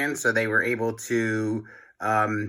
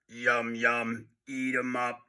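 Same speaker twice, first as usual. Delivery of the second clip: evil toilets; 8 dB quieter, slower than usual. A narrator's reading. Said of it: "they cried".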